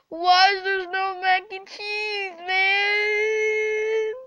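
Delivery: high wailing sobs; fake crying. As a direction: wail it out like a fake tantrum. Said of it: "and fake cry".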